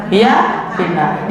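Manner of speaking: Hiya finnar slowed, falling on hiya; drawn-out Hiya finnar to sink in; slow, deliberate restatement; slow repeat. Shown as a demonstration. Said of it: "repeated slowly".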